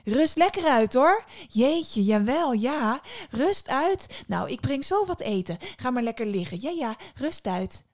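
put-on high, loud, sing-song old lady; excitable old woman, easing off at the end; fussing old woman. A narrator's reading. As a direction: fuss over the guest insistently. Old woman fusses over her guest.

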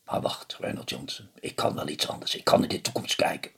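low, whispered confidence; secretive hush. A narrator's reading. Old man confides to Reynold in a low whisper.

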